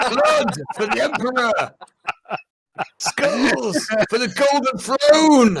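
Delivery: high-pitched frenzied war cry, drawn out at the end; battle shout. Acted. Frenzied fighter crying out for Emperor and Throne, shouted. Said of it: "I'm gonna shout".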